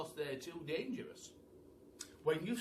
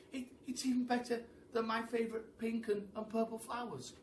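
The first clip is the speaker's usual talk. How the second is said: giraffe voice; 9 semitones higher than usual. Acted.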